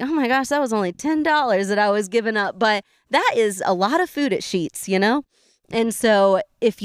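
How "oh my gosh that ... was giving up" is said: high, quick amazed voice; amused disbelief. Retold or imitated. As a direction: marvel at how small it was. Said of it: "you're like".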